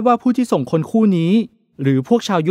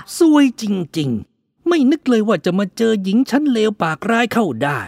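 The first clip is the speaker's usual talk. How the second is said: grumbling; 5 semitones higher, slower than usual. A narrator's reading. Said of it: "บ่นลอยลมมาว่า".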